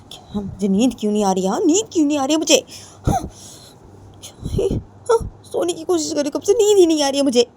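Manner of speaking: high, loud furious ranting; girl ranting furiously in bed; angry rant. A narrator's reading. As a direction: vent your rage at the friend. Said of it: "Ritu उसे अपने बोलती है".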